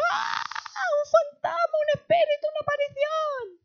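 very high hammed-up panicked shrieking; acted high wailing fright; cries of fear. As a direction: shriek it out in mock terror. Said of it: "Y dieron voces de miedo".

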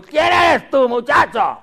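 high, shouted challenge; hammed-up contemptuous shout; scornful giant. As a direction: bellow down at a mere boy. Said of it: "Y lo tuvo en poco".

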